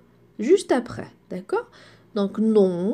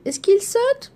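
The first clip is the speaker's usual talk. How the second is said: high question; 10 semitones higher than usual. Shown as a demonstration. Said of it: "teacher asks example question".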